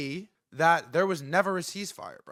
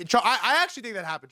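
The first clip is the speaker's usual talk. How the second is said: ordinary delivery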